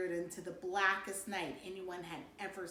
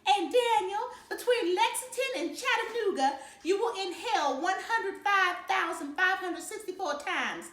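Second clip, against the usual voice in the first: high southern voice; 8 semitones higher, 5 dB louder than usual. Retold or imitated. Dad mimicking mom's trip facts in a high Southern voice. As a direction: play a chatty Southern tour guide, pitched way up. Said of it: "Dad made his voice go kinda high and southern".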